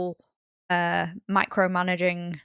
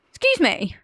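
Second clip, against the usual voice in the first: high, indignant telling-off, drawn out at the end; mock scolding; 5 semitones higher, 4 dB louder, slower than usual. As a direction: playfully take a parent to task. Retold telling-off of dad.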